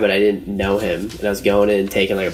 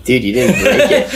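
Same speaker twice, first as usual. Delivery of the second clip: higher and louder, laughing through it; laughing disbelief; 6 semitones higher, 5 dB louder than usual. Retold or imitated. Telling Scott his thumb isn't broken, laughing. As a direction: crack up while telling him. Laughing at friend's fake break.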